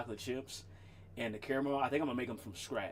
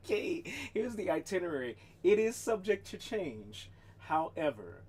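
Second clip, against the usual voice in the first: hammed-up official notice; mock announcement; 6 semitones higher, 5 dB louder than usual. Shown as a demonstration. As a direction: proclaim it like a town crier. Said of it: "I should put that on one of the streets".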